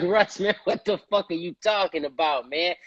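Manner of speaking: ribbing the director like he's gone senile; incredulous teasing. Retold or imitated. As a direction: ask if he's lost his mind, jokingly. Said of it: "I'm like".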